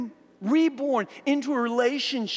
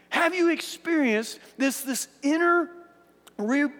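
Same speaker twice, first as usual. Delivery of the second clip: usual speaking voice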